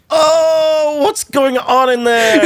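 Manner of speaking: very high, drawn-out singsong curiosity; hammed-up innocent wondering in a high voice; mock nosy eavesdropper. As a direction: coo it slowly, comically oblivious. Mocks himself eavesdropping at door.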